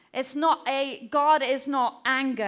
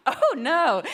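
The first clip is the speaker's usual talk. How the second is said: louder, drawn-out "oh no"; playful groan of dismay, half laughing; mock dismay; 2 semitones higher, 6 dB louder than usual. Preacher telling congregation of one slot for a big topic, mock dismay. Retold dismay at podcast count.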